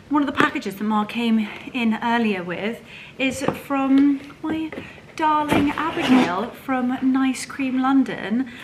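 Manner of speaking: usual speaking voice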